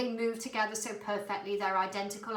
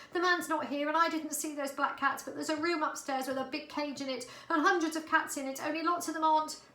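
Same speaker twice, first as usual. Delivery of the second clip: a hint of a shaken street cat, higher; lightly voiced young she-cat, upset; Holly, light young cat voice; 8 semitones higher, 3 dB louder than usual. A narrator's reading. Holly's voice, high.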